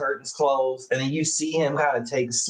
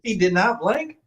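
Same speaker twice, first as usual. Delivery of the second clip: surprised; 8 semitones higher than usual. Real, not acted. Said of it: "that's terrifying".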